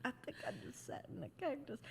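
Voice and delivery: high, hushed and quick, caught off guard; dismayed realization. Retold or imitated. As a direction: let it dawn on you that it hurts. Realizing she sat in cactus.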